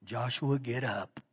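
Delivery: soft, gentle and hushed; tender voice. Shown as a demonstration. Preacher showing God speaking tenderly to Joshua, softly. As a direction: coax him up gently, almost whispering. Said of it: "I don't believe he said in a real tender voice".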